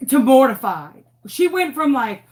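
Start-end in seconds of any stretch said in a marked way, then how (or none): 0.1-1.0 imitated gasp. Mother shocked at the drawing.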